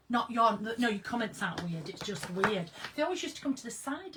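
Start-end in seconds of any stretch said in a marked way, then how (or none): none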